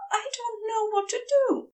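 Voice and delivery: a frightened angel losing his nerve, rushing; tearful panic. A narrator's reading. Frantic angel at a loss, tearfully panicking. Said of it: "his cheeks wet and lungs burning".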